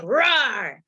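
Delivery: playful mock roar at someone. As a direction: roar like an angry beast, half-joking. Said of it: "when you're angry and aggressive".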